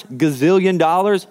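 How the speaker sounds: ordinary talk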